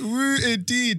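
slow, high, loud whoop; excited whoop. Real, not acted. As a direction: stretch the woo out, cheering. Cheering, slowed and stretched.

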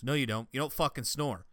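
ordinary delivery

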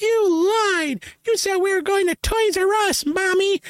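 very high-pitched wail of accusation; hammed-up tantrum cry in a squeaky register; mock childish yell. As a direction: yell to Mommy like a cheated kid. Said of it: "I'll yell it really loud".

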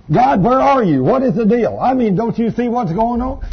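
loud, high-pitched mock grumble to God; acting out an indignant grumbler; mock complaining. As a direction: complain to God in hammed-up exasperation. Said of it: "I told you not to grumble and complain".

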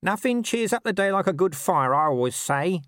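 high-pitched character voice for a chatty underling; chirpy corporal. A narrator's reading. Corporal bringing the tray in to his captain, chirpy chatter. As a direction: make small talk in a high, cheery voice.

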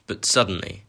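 ordinary delivery, nothing marked

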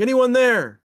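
high, loud call into the dark; yelling into the woods. Retold or imitated. Friend calling out loudly to the ghosts in the dark woods. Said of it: "yelling into the woods".

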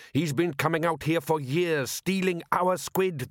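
indignant fisherman building his accusation; indignant rant. Acted. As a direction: complain bitterly about the rival. Father rants about rival thief.

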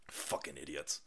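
ordinary talk, nothing marked

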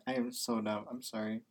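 ordinary talk, nothing marked

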